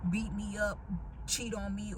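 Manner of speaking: usual speaking voice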